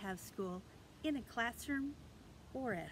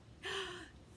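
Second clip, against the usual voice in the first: excited puppet gasp; 8 semitones higher, 6 dB quieter than usual. Pretend. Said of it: "Milo is here".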